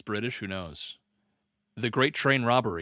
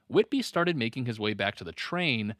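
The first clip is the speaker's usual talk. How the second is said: ordinary talk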